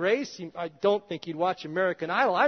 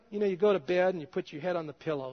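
ordinary talk, nothing marked

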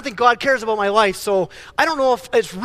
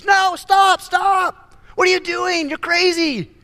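half-playful alarmed outburst; mock shout; 10 semitones higher, slower than usual. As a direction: yell at a character walking into disaster. Shouting at someone in a movie.

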